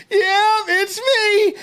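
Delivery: very high, thrilled retold voice; overjoyed reply. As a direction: mimic an elated relative, pitch soaring. Cousin's joyful reply, acted.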